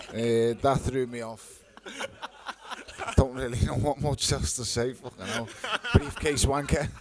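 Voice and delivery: laughing it off, thrown off. Real, not acted. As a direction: still cracking up after the heckler. Recovering from heckler, still laughing.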